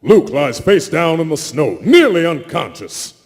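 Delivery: ordinary delivery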